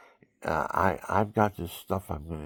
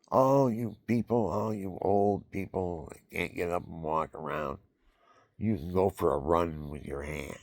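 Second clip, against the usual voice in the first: ordinary delivery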